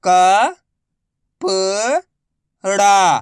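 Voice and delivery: very slow, each syllable drawn out. Shown as a demonstration. Teacher sounding out a word for pupils, slow syllables. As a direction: spell the word out slowly for a child. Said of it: "मैं किस तरीके से".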